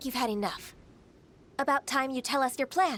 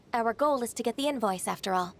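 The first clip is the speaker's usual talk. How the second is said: ordinary delivery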